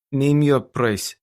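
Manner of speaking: put-on slow, insistent Russian accent. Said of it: "A heavy Russian accent came over the line".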